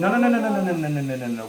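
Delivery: alarmed no. Real, not acted. Mom stopping her son from flinging meat, loud alarmed no's. Child shaking meaty hands around.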